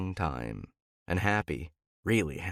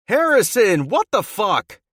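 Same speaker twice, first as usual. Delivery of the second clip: much higher, much louder angry yell; 11 dB louder than usual. A narrator's reading. Teammate yells through the bathroom door, loud and angry. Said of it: "someone yells into the bathroom".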